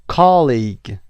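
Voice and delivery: "Colleague" with a loud COL-; stress on first syllable. Shown as a demonstration. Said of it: "قسمت اول".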